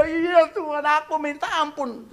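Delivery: high, loud pleading; begging voice. Retold or imitated. Host begging God for forgiveness, high loud pleading. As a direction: cry out for mercy.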